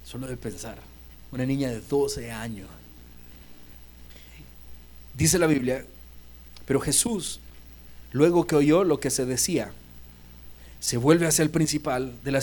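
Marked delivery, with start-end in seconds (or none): none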